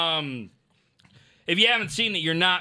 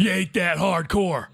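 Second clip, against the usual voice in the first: Stone Cold voice; 3 semitones higher than usual. Shown as a demonstration. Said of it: "in your best Stone Cold voice".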